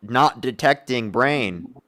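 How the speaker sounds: very slow robotic error message; drawn-out computer voice; glitching robot. Pretend. Mocking opponent as broken computer.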